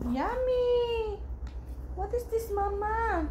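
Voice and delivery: high, sing-song feeding voice. Real, not acted. Mother coaxing baby to eat. (mataas at malambing na boses pambata)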